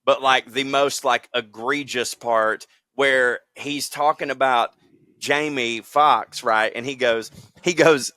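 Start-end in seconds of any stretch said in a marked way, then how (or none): none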